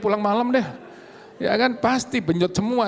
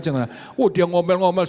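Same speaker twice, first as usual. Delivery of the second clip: ordinary talk, nothing marked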